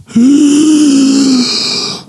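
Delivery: long, high audible gasp; big theatrical inhale. Retold or imitated. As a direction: suck in air before the final note. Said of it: "You will hear her go".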